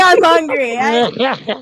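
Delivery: whimpering fake cry, like a spoiled kid; mock whiny hungry. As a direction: whine it like a hungry baby. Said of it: "He's like".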